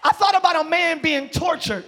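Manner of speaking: emphatic preacher's cadence, key phrases stretched; fervent preaching. Real, not acted. Preacher telling the church help is on the way, fervent preaching. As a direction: proclaim it to rouse the congregation.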